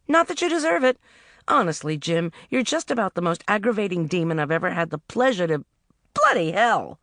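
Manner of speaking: lightly voiced heroine giving orders; heroine's voice. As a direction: heroine telling Jim off and getting on with the day. Heroine scolds, startled by taxi.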